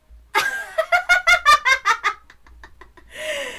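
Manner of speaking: long, high, loud laugh; hearty cackle. Acted. Club leader amused by a surprise request, cackling. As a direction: burst into loud rolling laughter.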